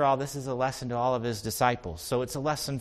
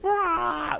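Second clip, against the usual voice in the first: high-pitched mock "rah"; wild puppy noise. Pastor imitating an untrained puppy, wild yell.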